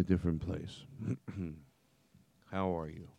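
soft hushed "different place" voice. Pretend. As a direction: drop to a near whisper. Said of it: "take the reverb out of our voices".

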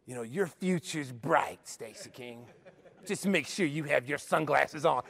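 slow, lightly teasing coach; a hint of dry joking, drawn out; mock sarcastic.

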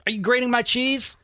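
"grating my cheese" with pitch high, ending on a rise; peak of the giddy list. Retold or imitated.

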